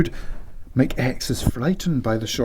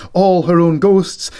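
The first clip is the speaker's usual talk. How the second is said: normal talking voice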